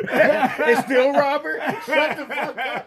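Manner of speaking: high, slow laughing retort. Real, not acted. Friend laughing at the purse story.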